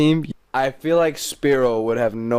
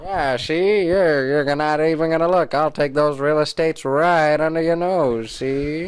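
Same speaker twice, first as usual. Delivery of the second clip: lightly voiced wise guy, pitched up; old-movie gangster; 4 semitones higher than usual. Rival agent taunting over cigars, mock gangster voice. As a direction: play a mock cigar-chomping tough guy.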